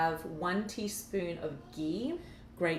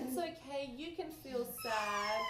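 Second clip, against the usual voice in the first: soft comfort; 6 semitones higher, 3 dB quieter than usual. Real, not acted. Comforting the crying child.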